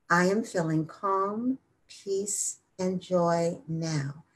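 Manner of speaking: slow, soft meditative affirmation; unhurried mantra with long final words; slow repetition. Shown as a demonstration. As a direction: let each phrase settle, drawn out and hushed. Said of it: "And then slowly repeat".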